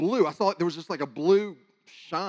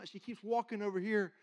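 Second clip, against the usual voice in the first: ordinary talk, nothing marked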